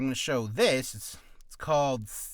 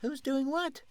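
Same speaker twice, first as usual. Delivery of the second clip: high-pitched put-on voice; questioning second character; 11 semitones higher, slower than usual.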